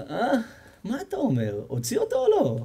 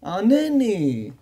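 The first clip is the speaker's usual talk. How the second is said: pleading cry; 8 semitones higher than usual. Acted. A desperate man calling to God, high and pleading. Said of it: "והתחיל לצעוק".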